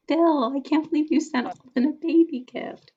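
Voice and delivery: high, excited disbelief. Retold or imitated. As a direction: squeal it like a thrilled surprise. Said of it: "I was like".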